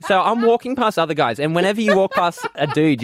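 normal talking voice

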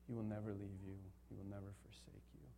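hushed reassurance. Real, not acted. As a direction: let the voice drop gently to reassure. Preacher softens for a solemn reassurance.

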